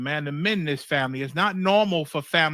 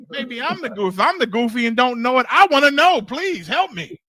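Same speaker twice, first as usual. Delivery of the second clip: rising, louder mock plea for help; pleading cry; 6 semitones higher, 7 dB louder than usual. Preacher playing the confused brother, begging loudly for help. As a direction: beg for help like a lost brother, voice climbing.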